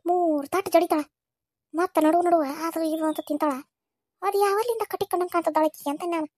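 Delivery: ordinary talk, nothing marked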